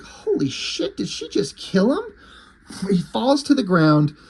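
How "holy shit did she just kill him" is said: shocked exclamation. Retold or imitated. Kitty shocked at the blast.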